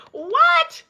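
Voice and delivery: very high, stunned what; can't believe what she heard; a squeak of disbelief; squeaky disbelief. Real, not acted. Disbelief after laughing.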